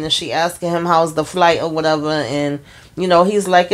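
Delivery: ordinary talk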